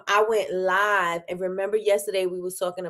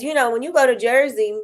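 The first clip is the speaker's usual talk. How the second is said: usual speaking voice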